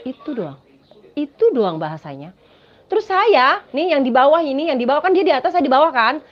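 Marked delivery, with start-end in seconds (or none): none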